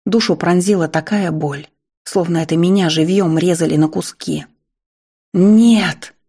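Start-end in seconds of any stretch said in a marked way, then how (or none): 5.3-6.1 loud, high despairing cry; desperate scream. A narrator's reading. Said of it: "закричала я, отчаянно".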